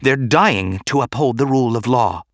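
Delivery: heated defence; said hotly. A narrator's reading. Padme defending the Jedi's sacrifice, hotly. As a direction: insist with a touch of heat. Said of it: "Padme said hotly".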